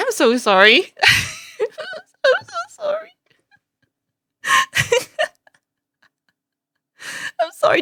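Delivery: helpless giggles squeezing the words high; laughing apology. Real, not acted. Viewer apologising for childish giggling, laughing helplessly. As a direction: apologise while losing it to real laughter.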